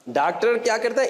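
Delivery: usual speaking voice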